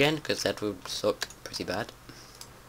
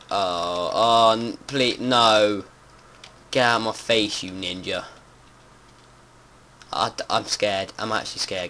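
ordinary delivery

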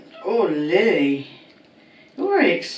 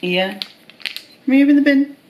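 ordinary delivery

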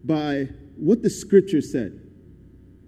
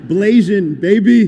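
playful boast; 2 semitones higher, 8 dB louder, slower than usual. Real, not acted. Preacher joking about his heritage, loud and proud. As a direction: sell it with a grin to the crowd.

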